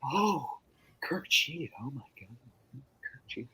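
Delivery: very soft background-extra murmur; hushed mock shock. Extras reacting to Kirk's cheating, hushed mock shock. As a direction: murmur in feigned shock, barely audible.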